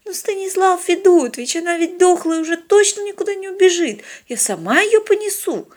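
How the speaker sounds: put-on very high, whiny pleading girl. A narrator's reading. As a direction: beg to keep the catch. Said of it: "цеплявшуюся за добычу с умоляющим". (умоляюще, тонким голосом)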